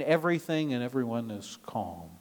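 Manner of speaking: ordinary talk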